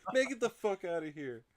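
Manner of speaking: high, loud mock outrage; retold angry outburst. Imitating the man on the toilet, mock angry yell. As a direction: imitate an annoyed man telling someone off.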